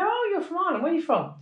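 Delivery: high, bright mock delight at meeting; a sudden high, eager call of recognition; excited greeting. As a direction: chirp it up like spotting a fellow Irish person. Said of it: "you go".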